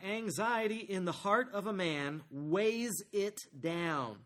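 slow, weighty reading of the verse; slow scripture reading. Acted. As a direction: let each word of the verse sink in.

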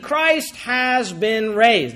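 solemn, very slow declaration; slow emphatic proclamation. Acted. Preacher repeats the key claim.

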